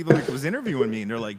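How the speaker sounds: ordinary delivery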